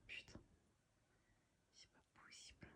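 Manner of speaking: breathless whispered disbelief; hushed, despairing whisper. Real, not acted. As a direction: whisper it to yourself in dismay. Exasperated over the broken watch strap.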